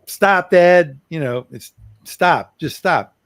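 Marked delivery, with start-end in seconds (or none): 0.1-1.0 put-on child's stop call. Kids told to stop dad. 2.0-3.2 firm stop. Retold or imitated. Retold partner interrupting him.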